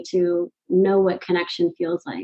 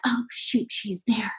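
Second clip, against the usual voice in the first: softly retold "uh-oh" moment; mock-furtive, under the breath; hushed dismay; 6 dB quieter than usual. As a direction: drop to a hushed inner aside. Retelling dread at spotting teacher.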